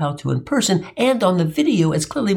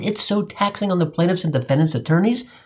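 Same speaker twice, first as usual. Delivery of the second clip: normal talking voice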